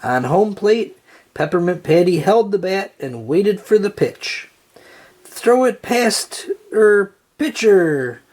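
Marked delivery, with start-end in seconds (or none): 5.2-8.2 slow, high call from the outfield; drawn-out shout to the pitcher. A narrator's reading. Lucy calling out to Charlie Brown, slow and high. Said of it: "Lucy cried from the outfield".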